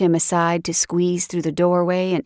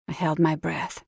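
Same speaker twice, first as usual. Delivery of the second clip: plain reading voice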